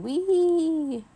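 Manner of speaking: loud, high whoop of joy; gleeful whoop. A narrator's reading. Old vampire grandpa whoops remembering stormy flights. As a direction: soar up into a whee.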